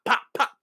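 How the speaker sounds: high mock pops; gunshot noises. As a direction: pop the shots out quickly. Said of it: "he's just pop".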